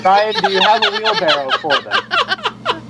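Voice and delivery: ordinary talk, nothing marked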